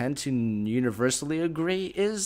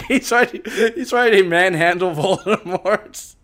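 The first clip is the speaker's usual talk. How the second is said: laughing; 4 semitones higher, 5 dB louder than usual. Real, not acted. Laughing while describing the image.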